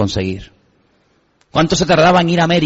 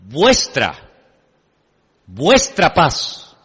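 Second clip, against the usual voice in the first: "vuestra" drawn out very slowly and weighted, then "paz"; slow emphatic "vuestra paz"; stress on vuestra paz; much slower than usual. Shown as a demonstration. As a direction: lean hard on "vuestra paz", stretching each syllable. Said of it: "el Señor no dice, "Mi paz".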